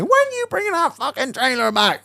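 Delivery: high-pitched nagging complaint; mock whining. Old man ringing about his trailer, high mock whine. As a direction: mimic someone bitching down the phone. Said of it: "bitching and whining".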